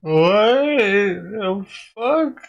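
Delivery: slow, high, laughing what the fuck; laughing disbelief. Real, not acted. Watching the clip in disbelief, slow laughing outburst. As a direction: drag it out through a laugh.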